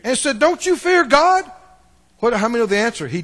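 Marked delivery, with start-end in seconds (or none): 0.4-1.5 higher, louder rebuke; higher sharp reproach; rebuking thief. Retold or imitated. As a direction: scold the other thief. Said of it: "rebuked him".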